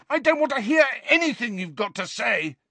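high, loud refusal; boy refusing to listen; angry shout. A narrator's reading. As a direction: shout him down. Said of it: "Harry roared".

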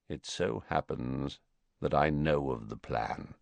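low, soft and measured. Acted. Snape reveals he knows the plan, low and soft. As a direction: drop the voice, confiding. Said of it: "he said in a low voice".

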